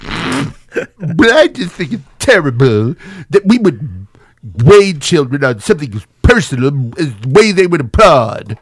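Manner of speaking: slow, whiny, mopey man; droopy dog guy. Retold or imitated. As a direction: moan it out like a sad droopy dog. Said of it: "the droopy dog guy".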